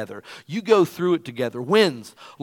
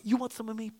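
mock tough-guy challenge; 8 semitones higher, 7 dB quieter, faster than usual. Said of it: "Oh, I'm mad".

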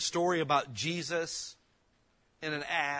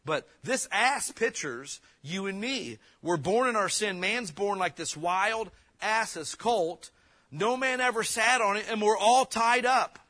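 fervent preaching; 6 semitones higher, 6 dB louder than usual. Real, not acted. Preaching at full force.

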